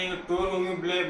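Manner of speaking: normal talking voice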